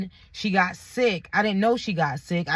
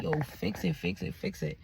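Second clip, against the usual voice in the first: much lower, softer imitation of pleading; retold begging; 7 semitones lower, 9 dB quieter than usual. Boyfriend on hands and knees, retold begging. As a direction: mimic his repeated plea, sinking very low.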